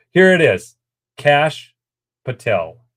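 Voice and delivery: normal talking voice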